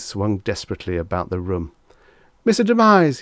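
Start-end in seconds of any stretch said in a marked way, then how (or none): 2.4-3.2 high, loud panicked call; frantic cry. A narrator's reading. Reggie calling to the vanished agent, frantic cry. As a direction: call out in alarm, pitched way up. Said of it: "he cried".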